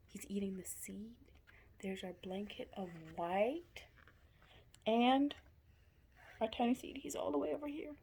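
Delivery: plain reading voice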